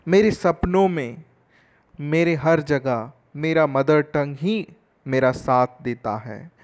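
normal talking voice

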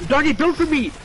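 high, loud yell for help; urgent shout. Real, not acted. Yelling at a teammate to build for him, urgent shout. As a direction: call out sharply for cover.

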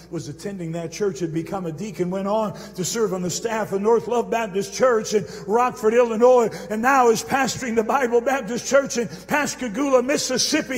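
sermon building to a loud climax; rising, ringing revival preaching; preaching at full force. Acted.

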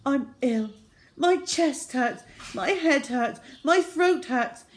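high, loud put-on whine; whining sick child. Boy listing his pains to the nurse, whining. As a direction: lay the illness on thick.

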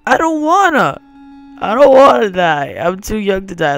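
high, loud pleading whine; trembling whiny yelps. Pretend. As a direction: beg for your life, whining. Pleading not to die.

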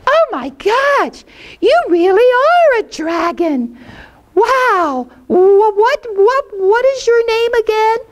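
put-on high child voice calling out into a cave; a small boy hollering hello into the dark; little boy calling. Little Jackie Paper calling into Puff's cave, high child voice.

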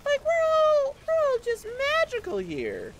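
sing-song denial of disaster; mock falsetto. Player mocking his own optimism while troops die, falsetto. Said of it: "I'm just like".

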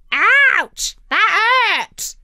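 very high, loud yelp; shrieking hurt bird voice; parrot screech. A narrator's reading. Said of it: "screeched the parrot".

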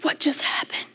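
stunned whisper to herself. Retold or imitated. Alone on the plane, whispering her stunned thought. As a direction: breathe it out in disbelief.

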